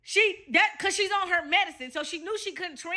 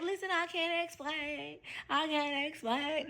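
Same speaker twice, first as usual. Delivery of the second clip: softer, slow, mild imitation of a caught excuse; caught pleading; 9 dB quieter, slower than usual. Caught girlfriend dodging her angry boyfriend, mildly imitated softly and slowly. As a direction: let it fall away, weakly dodging.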